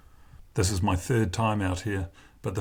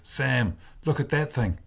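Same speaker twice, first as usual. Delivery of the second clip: higher, urgent call to a mate; awestruck, raised-pitch outburst; excited exclamation; 5 semitones higher than usual. A narrator's reading. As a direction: point out the monster wave, pitched up. Anto exclaims at giant wave.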